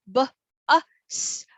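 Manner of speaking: "b, a, s" as three separate letter sounds; sounding out. Shown as a demonstration. Teacher breaks down bus, sounding out "b, a, s". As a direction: say each sound of "b, a, s" alone. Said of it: "our sound hound".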